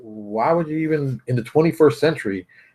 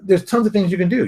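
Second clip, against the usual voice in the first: usual speaking voice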